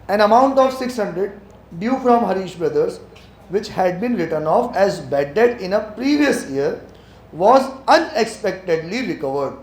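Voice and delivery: very slow, careful reading in English; reading slowly. Shown as a demonstration. Teacher reading the question slowly for students weak in English. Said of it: "अब मैं धीरे धीरे पढ़ रहा हूँ".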